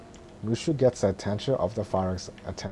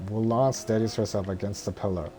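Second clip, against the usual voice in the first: ordinary delivery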